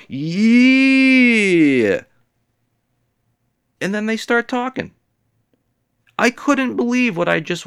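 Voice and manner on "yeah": high, loud, drawn-out yeah; smooth yeah. Retold or imitated. Catcaller answering the woman, high loud drawn yeah. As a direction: echo her with a smooth yeah. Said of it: "then he's like".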